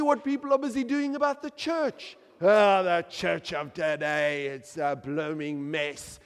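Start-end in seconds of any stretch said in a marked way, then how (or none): 2.4-6.2 dismissive. Pretend. Quoting people who scorn the church.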